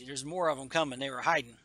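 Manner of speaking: ordinary talk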